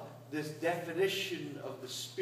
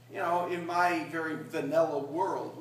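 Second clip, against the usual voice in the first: normal talking voice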